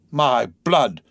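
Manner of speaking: vexed exclamation. Acted. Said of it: "ejaculated the vexed coachman".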